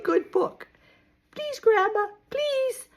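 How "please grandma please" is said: high, soft begging; a small, coaxing please; pleading. Acted. Moo Moo coaxes Grandma to read now, pleading.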